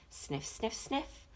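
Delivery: quick soft sniffs, rising; sniffing sounds. Acted. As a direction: sniff like a dog on a scent. Dog sniffing in story.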